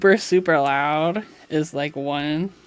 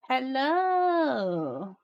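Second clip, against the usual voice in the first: high drawn-out cooing hello; pet greeting; 8 semitones higher, 4 dB quieter than usual. Real, not acted. Streamer greeting her cat, high drawn-out coo.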